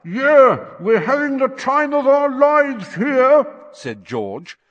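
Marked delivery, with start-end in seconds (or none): 0.0-3.4 louder, higher, squashed and muffled; muffled as if pressed to a wall. Acted. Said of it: "voice sounded muffled".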